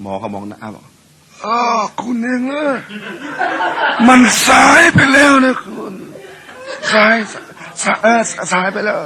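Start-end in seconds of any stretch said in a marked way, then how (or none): 1.1-9.0 much higher, loud, laughing through it; mocking doctor. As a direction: gloat that it's too late, cackling. (หมอหัวเราะเยาะว่าสายไปแล้ว)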